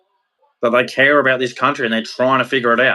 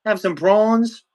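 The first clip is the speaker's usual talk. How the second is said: hammed-up broad Australian accent, higher, slowing on the end; broad Aussie ocker; 6 semitones higher than usual. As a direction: lay the Australian accent on thick like a barbie invite. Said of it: "We used to say".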